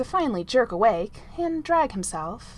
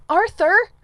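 much higher, louder scolding; high, loud wake-up scold; great-aunt Ethelberta tones; 11 semitones higher, 11 dB louder than usual. A narrator's reading. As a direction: rouse him like a stern great-aunt. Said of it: "hissed, in tones reminiscent of Percy's great-aunt Ethelberta".

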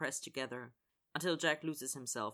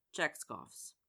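usual speaking voice

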